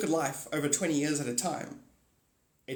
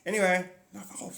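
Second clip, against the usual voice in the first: normal talking voice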